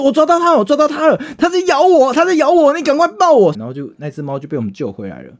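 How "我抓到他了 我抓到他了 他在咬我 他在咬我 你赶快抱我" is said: very high, loud frantic screaming; dangling off a third-floor balcony, yelling at his friend; panicked shriek. Retold or imitated. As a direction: shriek while a cat bites you in midair. Dangling from balcony, cat biting.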